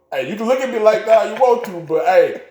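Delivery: high, loud, excited insistence; animated pushback. Real, not acted. Pushing back at cohost's look.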